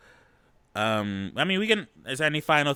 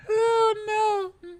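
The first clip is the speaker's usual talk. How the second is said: high, shrill squeal of disgust; shocked shriek. Real, not acted. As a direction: squeal it out in horror.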